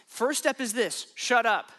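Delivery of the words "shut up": louder, abrupt order; playfully forceful dismissal of a demon; blunt command. Acted. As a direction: cut them off loudly.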